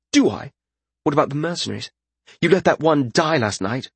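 high-pitched, accusing lordling; high, reproachful character voice; challenging young lord. A narrator's reading.

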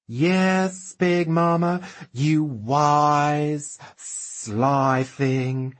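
high, lisping, camp drawl. Acted. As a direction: lay on the camp and dazzle. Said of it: "drawling it out, turning up the lisp, the camp".

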